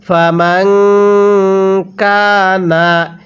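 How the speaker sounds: slow recitation of ফামান কানা with a held nasal; Quran recitation. Shown as a demonstration. Teacher leads students through the verse, slow chant. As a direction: stretch ফামান কানা out slowly. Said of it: "এরকমভাবে শিখব।".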